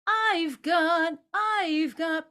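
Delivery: I've got sung high; starts on top. Shown as a demonstration. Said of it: "starts on the top".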